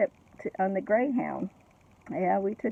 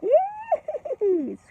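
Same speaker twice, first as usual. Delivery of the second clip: put-on very high, sharp baby shriek of delight; a happy baby's high-pitched giggle-squeal; imitated baby squeal; 5 dB louder than usual. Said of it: "Darren had a real high-pitched laugh".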